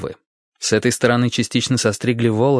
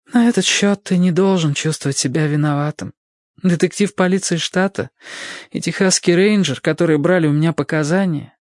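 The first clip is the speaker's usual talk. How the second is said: put-on young woman explaining, high voice; high woman's voice, calmer; 8 semitones higher than usual. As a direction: voice her steadier now. Narrator voices Sadie.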